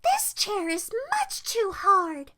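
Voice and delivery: high, slow whiny moan; moaned complaint.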